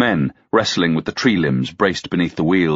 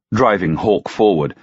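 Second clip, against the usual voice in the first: ordinary delivery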